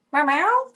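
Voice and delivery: high, loud meow with a rising lilt; questioning meow. Shown as a demonstration. Calling out to find the upset cat, meowing. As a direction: call to the cats with a meow. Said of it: "that's me meowing".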